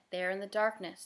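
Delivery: ordinary delivery, nothing marked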